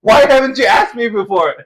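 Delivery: high, loud wail of disbelief; mock exasperated outburst. To Allison who forgot where they're from, loud mock exasperation. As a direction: cry out in hammed-up exasperation. Retold outburst at forgetful coworker.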